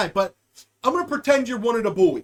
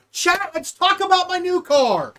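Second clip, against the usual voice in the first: excited announcement; 8 semitones higher, 4 dB louder than usual. Real, not acted.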